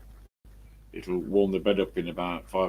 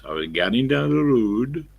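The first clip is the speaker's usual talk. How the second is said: raised, sing-song Geordie lilt; Geordie accent; 4 semitones higher than usual. Retold or imitated. Bloke talking to his mate, Geordie accent. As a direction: do a Geordie, lifting into the last word. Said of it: "you got the Geordies".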